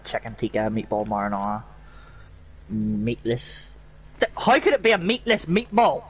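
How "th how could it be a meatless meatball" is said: high-pitched incredulous question; baffled disbelief. Real, not acted. Baffled by a menu item.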